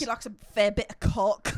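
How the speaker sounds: loud put-on British delivery; mock British accent. Topping the friend's joke, loud mock British accent. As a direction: land the joke in exaggerated British. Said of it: "making fun of accents".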